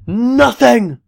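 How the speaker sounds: shocked high cry of disbelief; outraged surprise. Real, not acted. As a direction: exclaim it in mock outrage. Host told he gets nothing.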